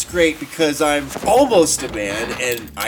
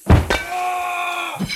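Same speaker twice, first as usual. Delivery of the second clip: pained yelp. Acted. Attacked in the closet.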